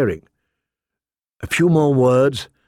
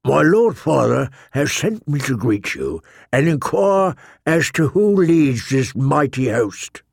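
put-on polite old knight. A narrator's reading. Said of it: "Yet he was polite enough".

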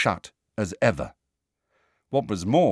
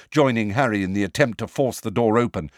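usual speaking voice

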